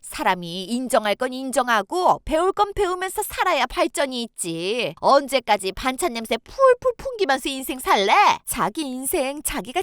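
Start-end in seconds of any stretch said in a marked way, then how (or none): none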